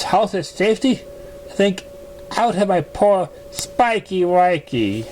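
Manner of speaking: a refined mare, gently defensive; Rarity-like lady. Acted. Narrator voices the same lady.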